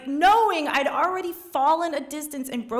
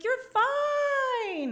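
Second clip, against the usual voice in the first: high, sing-song you're fine; airy chirp from far below; sister's breezy reassurance; 13 semitones higher than usual. Retold or imitated. Sister belaying her, high breezy dismissal. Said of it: "she's like".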